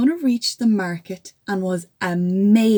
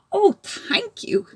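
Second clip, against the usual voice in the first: high relieved thanks; grateful giant; 13 semitones higher than usual. Acted. Giant thanking the girl, high relieved voice. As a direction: sigh out your gratitude.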